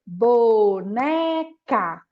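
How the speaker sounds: very slow BO... NE... CA, each beat loud; dividing into syllables. Shown as a demonstration. Teacher dividing a word with palm claps, slow and emphatic. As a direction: clap out BONECA in three long separate syllables. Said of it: "primeiro a gente vai dividir".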